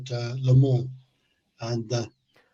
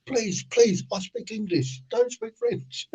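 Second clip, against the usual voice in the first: lightly voiced, higher-pitched plea; pleading charge hand; 8 semitones higher, slower than usual. French charge hand begs driver to stop, mock pleading. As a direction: beg as an exasperated foreman, half in jest.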